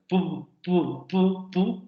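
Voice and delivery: "pu, pu" as sharp lip pops; demoed p sound. Shown as a demonstration. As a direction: release each "pu" abruptly. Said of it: "Hay dos órganos que se están tocando".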